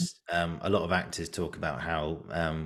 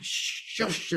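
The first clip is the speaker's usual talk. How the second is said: long, unvoiced hushing sound; mock-annoyed shush at a noisy neighbour; imitated shushing; 4 dB quieter than usual. Acting out hushing a noisy theatergoer, whispered shush.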